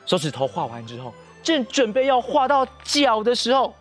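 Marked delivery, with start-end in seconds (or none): none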